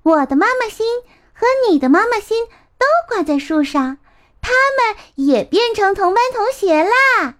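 put-on high, bright-eyed little boy voice, a bit quick; excited little boy. 小苹果 telling 豆豆 their hearts are classmates, high excited child voice. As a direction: bubble over like a delighted child.

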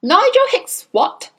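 high, loud furious bellow. A narrator's reading. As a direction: bellow the question at him. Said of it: "the Trunchbull bellowed".

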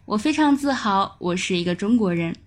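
ordinary delivery

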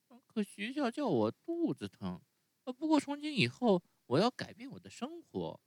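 高出许多的稚嫩童声，带着依恋; 像小木偶一样怯生生地撒娇; 木偶孩子的声音. Acted. Narrator voices Pinocchio. (用高高的童声扮演匹诺乔)

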